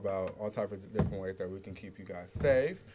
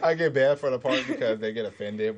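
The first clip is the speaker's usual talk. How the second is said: loud, higher, talking through laughter; real laughing; 4 semitones higher, 11 dB louder than usual. Real, not acted. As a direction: burst out laughing while protesting. Bursts out laughing at own story.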